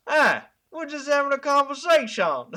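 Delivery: breezy Italian American plumber brushing it off; Italian American accent. Retold or imitated. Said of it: "in my Italian American accent".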